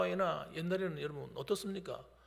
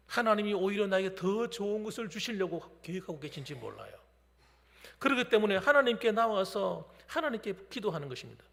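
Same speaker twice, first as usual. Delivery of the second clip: ordinary delivery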